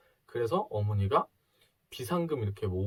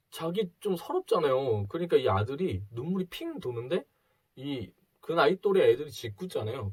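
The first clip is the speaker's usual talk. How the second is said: ordinary delivery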